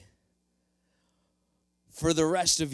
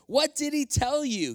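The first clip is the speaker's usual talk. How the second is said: high, eager question; can't wait to hear the praise; eager Saul, pitched way up; excited; 11 semitones higher, 4 dB louder than usual. Acted. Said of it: "Can you hear the excitedness in his voice?".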